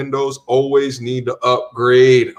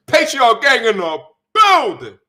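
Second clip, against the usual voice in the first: hype; 7 semitones higher, 3 dB louder than usual. Real, not acted. Hype announcement.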